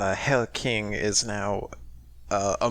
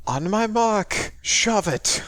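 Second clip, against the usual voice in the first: high, loud in-character order; drawn-out, raised rallying call; called-out command; 11 semitones higher, 5 dB louder, slower than usual. Acted. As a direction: shout the signal to your teammate. Player voices Yannick calling an order.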